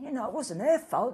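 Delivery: normal talking voice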